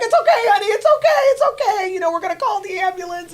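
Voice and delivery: high, loud soothing voice broken by laughter; farmer soothing. Retold or imitated. Farmer comforting the crying rider, high and soothing. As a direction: comfort her through her tears. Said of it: "He's like".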